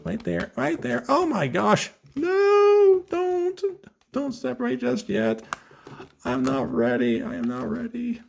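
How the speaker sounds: nervous. Real, not acted. Toy parts about to separate.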